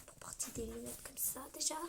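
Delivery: breathy whispered scoff; scornful under-the-breath whisper; indignant whisper. Real, not acted. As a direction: whisper your offense at the frames. Indignant aside about ugly glasses.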